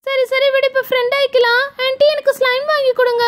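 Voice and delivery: put-on high-pitched child making up; coaxing little girl. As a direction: wheedle in a high girlish voice. Girl makes up, asks for slime. (கெஞ்சும் சின்னப் பொண்ணு குரல்)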